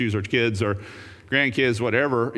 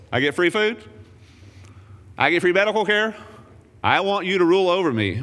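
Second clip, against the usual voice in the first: mock greedy crowd; 6 semitones higher than usual. Crowd voiced mockingly, wanting a king.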